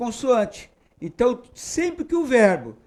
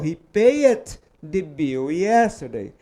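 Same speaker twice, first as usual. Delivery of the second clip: slow model sentence; 3 semitones lower, slower than usual. Shown as a demonstration. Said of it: "Ele tirou o Y e falou".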